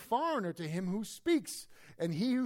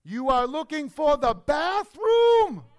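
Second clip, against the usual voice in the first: slow, high shout as if to a foreigner; loud, exaggeratedly slow talk for a non-English speaker; mock raised voice; 7 semitones higher, 11 dB louder, much slower than usual. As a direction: shout each word slowly, hammed-up. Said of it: "you have to raise your voice".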